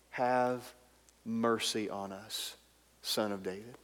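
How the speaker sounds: soft, slow pleading whisper of a prayer; hushed plea. Acted. Preacher softly voicing the blind men begging Jesus for mercy. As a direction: beg quietly for mercy.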